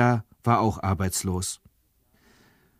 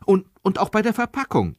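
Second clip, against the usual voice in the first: much higher, eager and generous; big-hearted eager offer; generously; 10 semitones higher, faster than usual. A narrator's reading. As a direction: chime in with a lavish offer. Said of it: "warf Frieda großzügig ein".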